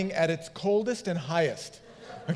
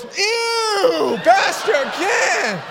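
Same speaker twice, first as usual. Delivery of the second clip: much higher, louder, dragged-out disgusted yell; disgusted kid; 12 semitones higher, 6 dB louder, much slower than usual. Retold or imitated. Teen boy in the tank recoiling from his pastor: loud disgusted yell. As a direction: pull away and wail in disgust. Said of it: "Isaac, the rat, goes".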